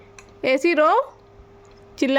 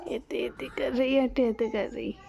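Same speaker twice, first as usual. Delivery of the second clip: soft whisper; 5 semitones lower, 7 dB quieter than usual. Real, not acted. Whispering beside sleepy baby. (सोती बच्ची के पास धीमी फुसफुसाहट)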